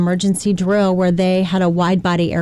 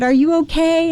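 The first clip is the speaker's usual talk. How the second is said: concerned father; 9 semitones higher than usual. Retold or imitated. Father checking on survivor daughter.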